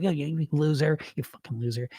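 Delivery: a quiet, sneering put-down; inner critic voice. Retold or imitated. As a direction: mutter it like the nasty voice in your head. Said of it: "this voice".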